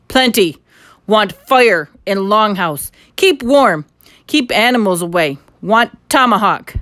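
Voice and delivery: loud, clipped broken-English demands; loud, gruff Indian voice; Indian chief demands. A narrator's reading. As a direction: bark out each demand loud. Little Bear makes demands.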